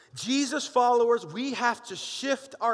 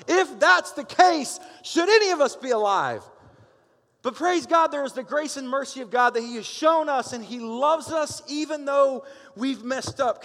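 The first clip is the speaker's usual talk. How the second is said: preaching at full force; 5 semitones higher than usual. Acted. Pastor rallies the congregation about grace, full-force preaching. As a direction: lift the room with praise.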